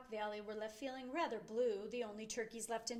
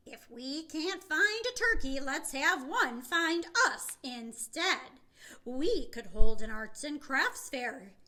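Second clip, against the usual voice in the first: Beezer voice; 3 semitones higher, 9 dB louder than usual. Acted. Beezer announces fair plan.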